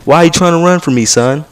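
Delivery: high, loud and fast, accusing; mock security guard. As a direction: bark it like a pushy mall cop chasing a kid. Security guard confronting fleeing teen.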